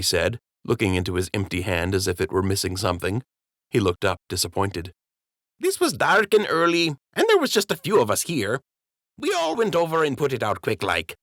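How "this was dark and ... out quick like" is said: high-pitched, downplaying the trouble; high dockhand voice making light of a fire; sheepish dockhand. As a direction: make it sound small and harmless, up high.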